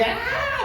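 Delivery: sudden high-pitched mock shriek of a sister overcome in church; imitated high shriek. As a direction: throw out a short, very high cry like someone seized by the spirit. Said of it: "empezaba a gritar".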